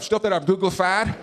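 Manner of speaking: folksy, clueless ex-president impression; George W. Bush. Former president explaining his paintings, folksy and clueless. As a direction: mangle it with simple confidence. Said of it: "when they ask him about that".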